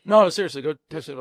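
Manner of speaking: mock insistent boss. Boss urging chef to greet guests.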